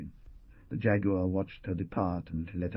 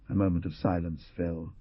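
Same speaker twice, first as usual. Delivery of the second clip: ordinary delivery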